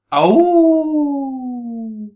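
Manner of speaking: slow high drawn-out howl; werewolf howl. Pretend. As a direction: stretch the howl out long. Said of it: "And then mine".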